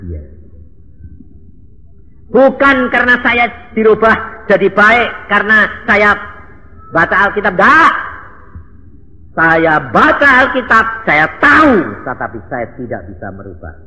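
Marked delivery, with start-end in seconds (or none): 2.3-11.7 high, loud fiery sermon; impassioned shouting preacher; preaching at full force. Real, not acted. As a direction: drive the point home to the congregation.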